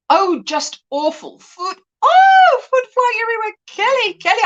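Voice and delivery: high, loud, revolted cry; exaggerated disgust. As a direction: shriek in disgust at food flying. Reading a viewer's disgusted comment.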